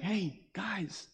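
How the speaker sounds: David softly inviting friends to magnify God with him; soft cheerful call. Retold or imitated. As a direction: draw them in quietly. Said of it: "He's like".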